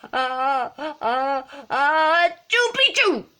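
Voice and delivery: high, building fake sneeze bursting loud; sneezing out words. A narrator's reading. Skipito sneezes the password into an ear, explosive mock sneeze. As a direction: build to a huge word-shaped sneeze. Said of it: "half sneezed, half spoke the secret password".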